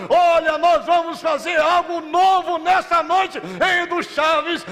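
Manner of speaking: high, booming proclamation to the angels; voice of God. Acted. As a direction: thunder it like God on his throne. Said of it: "Deus se levanta do trono nessa noite dizendo".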